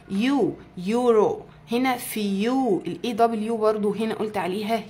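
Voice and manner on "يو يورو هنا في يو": يو and يورو stretched out very slowly; slow yoo. Shown as a demonstration. Teacher slowly modelling يورو for students.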